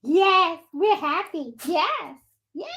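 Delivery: high, squeaky happy pet voice; playful baby-talk yes; speaking for the dog. Pretend.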